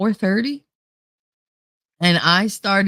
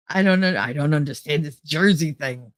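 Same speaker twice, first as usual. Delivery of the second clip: usual speaking voice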